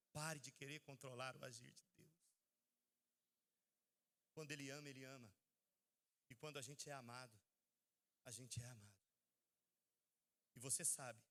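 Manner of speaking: voz baixa e suave, mais grave, quase confidencial; pregação íntima. Acted. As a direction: fale baixinho, como um segredo precioso. (pregação mansa e íntima, em tom baixo)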